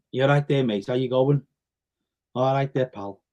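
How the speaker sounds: short friendly Scouse greeting; Scouse accent. Shown as a demonstration. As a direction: greet a mate in a Liverpool accent. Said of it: "Can you do the Scouse accent".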